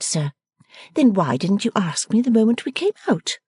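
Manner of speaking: playful daughter fussing at her father; Bella, young daughter. A narrator's reading.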